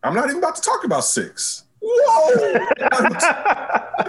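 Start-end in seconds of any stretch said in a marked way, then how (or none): 1.8-4.1 high, laughing whoop, then slower; excited whoop. Real, not acted. Excited outburst over a name.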